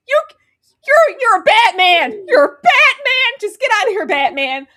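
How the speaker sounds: high, loud mock outburst; hammed-up indignant shout; acted shouting. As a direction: yell it like a sputtering, desperate comeback. Acting out a feeble angry comeback.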